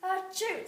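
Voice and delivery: big high sneeze; loud sneeze. Acted. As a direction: sneeze it out fully. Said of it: "sneezed the young girl".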